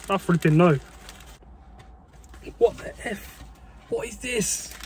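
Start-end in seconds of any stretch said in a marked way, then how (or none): 2.6-4.7 stunned whisper. Real, not acted. First look inside the burger.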